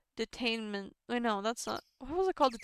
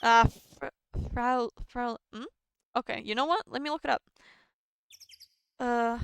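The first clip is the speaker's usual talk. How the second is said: ordinary delivery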